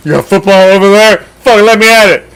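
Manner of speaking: drooling Homer Simpson. Retold or imitated. Football-mad fan craving any game, drooling Homer Simpson voice. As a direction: drool over it like a hungry cartoon dad. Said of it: "like Homer Simpson drooling over a donut".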